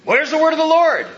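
loud, high taunting demand that then eases; scornful mocking. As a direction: jeer like a scoffer calling for proof. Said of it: "they say, scorning him, mocking him".

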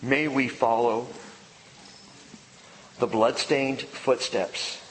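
hushed closing prayer to the Lord. Real, not acted.

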